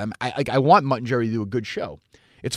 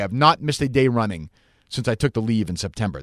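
usual speaking voice

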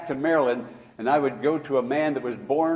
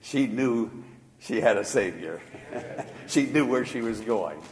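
usual speaking voice

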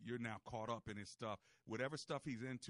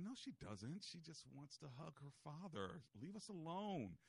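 higher, slow, softer playful defence; mock protective dad; 4 semitones higher, 7 dB quieter, slower than usual. Host playing himself shooing his wife. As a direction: shoo her away, teasing.

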